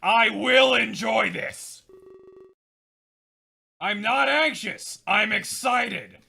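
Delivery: lightly voiced manic gamer; gleeful unhinged ramble; manic character voice.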